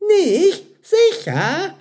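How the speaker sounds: very high, loud startled questions; flustered young server. A narrator's reading. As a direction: blurt it out high in disbelief. Flustered Mira questions a customer.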